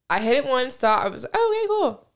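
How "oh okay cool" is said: breezy high voice. Retold or imitated. Friend retelling her first bong hit, breezy and high. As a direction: jump way up, light and casual.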